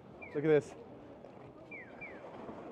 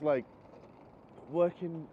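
ordinary talk

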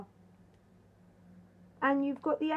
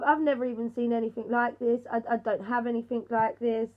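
ordinary delivery

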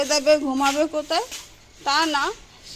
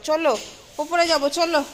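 calling out; 6 semitones higher, 7 dB louder than usual. Real, not acted. Calling child upstairs again.

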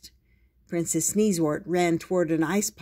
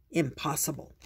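plain reading voice

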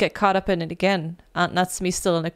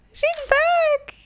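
much higher, thrilled shout; excited. Real, not acted. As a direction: cheer her return. Ami wakes up.

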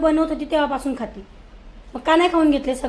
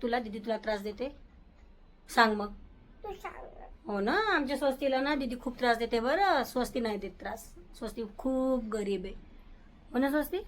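soft coaxing; 2 semitones lower, 9 dB quieter than usual. Real, not acted. Mother coaxes sulking child.

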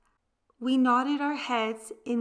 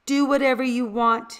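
ordinary delivery

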